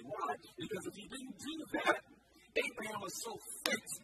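normal talking voice

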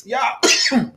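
coughing after swallowing wrong; strained voice after drink went down wrong; choking on a drink. Real, not acted. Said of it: "this drink went down the wrong pipe".

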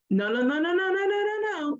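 mentor's rising string of no's, climbing high; rapid-fire dismissal. Retold or imitated. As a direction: cut them off with a run of high no's. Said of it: "That's how he said it".

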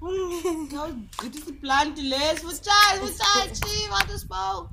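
high, loud laughing outburst; cracking up with excitement; excited. Real, not acted.

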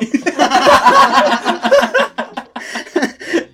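long, loud, high belly laugh; uproarious laughter. Real, not acted. Storyteller cracks up over his cousin's scar, roaring laughter. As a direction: lose it completely, laughing loudly.

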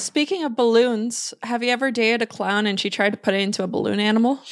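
ordinary talk

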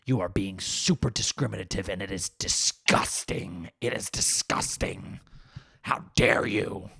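low, slow, whispery indignant activist; put-on hushed outrage; mock outrage. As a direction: seethe slowly, as if gravely offended. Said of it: "I'm surprised there's no outrage at that".

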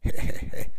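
unvoiced hehehe laugh; bear's breathy chuckle. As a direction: chuckle greedily through the breath. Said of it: "lausui karhu naurusuin".

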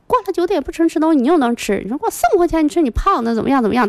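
high-pitched mock yelling, no real volume; retold scolding yell. Husband catching the child eating ice cream late, mock scolding yell. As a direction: imitate a husband's telling-off, pitched well up. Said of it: "然后他就喊说".

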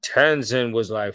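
usual speaking voice

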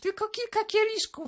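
put-on teenage daughter pleading with her father for the new car. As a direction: beg daddy like a spoiled teen girl. Daughter downplays her drinking.

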